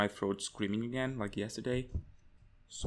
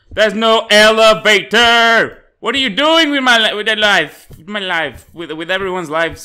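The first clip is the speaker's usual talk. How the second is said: loud, higher outraged rant; indignant yell; 6 semitones higher, 14 dB louder than usual. Real, not acted. Gamer outraged there's no elevator, loud. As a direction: rant at the game.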